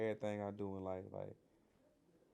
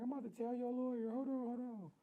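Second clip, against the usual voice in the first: much higher, rushed mother's voice; excited mama; 14 semitones higher than usual. Retold or imitated. Mother on the phone rushing to call the lawyer, excited. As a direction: squeal it like a mom who can't wait.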